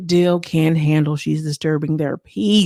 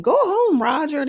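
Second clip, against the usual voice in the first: much higher taunting brush-off; mock dismissal; 9 semitones higher, slower than usual. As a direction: shoo him away up high, teasing. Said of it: "They're giving".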